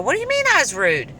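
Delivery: high, fast, offended question; indignant surprise. Retold or imitated. Son calls her rude, high fast indignant retort. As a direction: bristle at the accusation.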